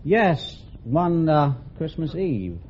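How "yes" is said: normal talking voice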